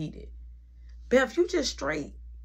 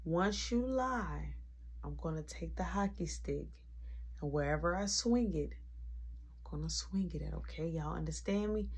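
soft, low, controlled warning as mom; eerily calm; 3 semitones lower, 12 dB quieter than usual. Retold or imitated. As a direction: keep it cool and quiet while threatening. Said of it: "So she was so calm".

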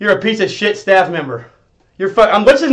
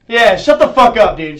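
usual speaking voice

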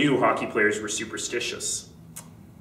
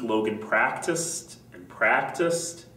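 ordinary delivery, nothing marked

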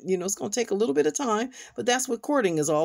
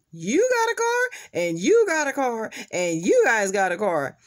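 Oprah giveaway; 3 semitones higher, 4 dB louder than usual. Retold or imitated. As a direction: hype the crowd like a TV host. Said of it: "Auntie Oprah is not gonna give nobody a car".

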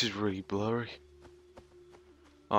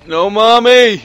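loud, high wail for mum; playful anguished scream; mock child's cry; 10 semitones higher, 13 dB louder, slower than usual. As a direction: cry out like a distressed child, hammed-up. Acts child calling for mother.

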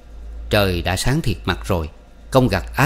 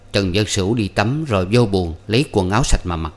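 ordinary delivery

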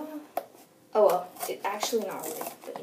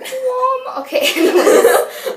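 high, loud, laughing the word out; laughing shout; 13 dB louder than usual. Real, not acted. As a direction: burst out laughing mid-shout. Cousin laughs while shouting.

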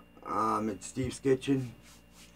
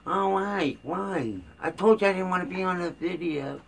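imitating wife's yelling; 8 semitones higher than usual. Husband mimicking his wife objecting to being videoed, high mock yelling. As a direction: put on a higher voice for a mock scolding. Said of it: "she yells at me".

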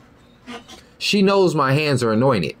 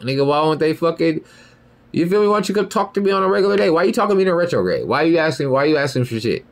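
normal talking voice

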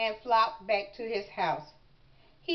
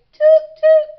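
very loud, high horn-like toots; 19 dB louder than usual. A narrator's reading. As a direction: hoot it out like a little whistle. Mother demonstrates the toot noise.

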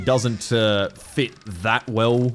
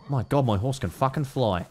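normal talking voice

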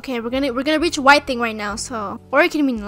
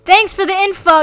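ordinary delivery

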